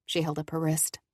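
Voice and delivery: usual speaking voice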